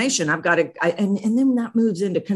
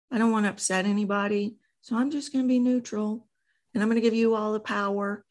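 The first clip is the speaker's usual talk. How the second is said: higher, softer, eager-to-please voice; people pleaser mask; 5 semitones higher, 4 dB quieter than usual. Shown as a demonstration. Coach demos the people pleaser voice to the host, higher and softer. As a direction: hover timidly, avoid any conflict. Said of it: "you've got the people pleaser mask".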